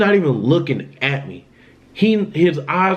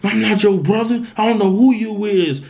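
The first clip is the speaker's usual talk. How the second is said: higher-pitched mock demonic threat; mild demonic menace, raised but not shouted; retold demonic voice; 6 semitones higher than usual. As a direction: lightly imitate a possessed brother, pitched up. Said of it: "a different demonic type voice".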